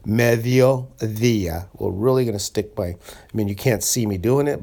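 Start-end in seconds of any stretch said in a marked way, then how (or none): 0.1-1.7 "Mediodía" very slowly, both D's as heavy th sounds; exaggerated soft D. Shown as a demonstration. Teacher exaggerating for learners who can't see the tongue, soft th-like D's. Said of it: "exaggerate it".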